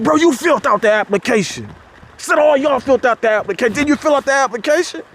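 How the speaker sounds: high, heated retold rant; mock-angry friend calling him out; imitating a mad coworker. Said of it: "he was mad. He was turned up".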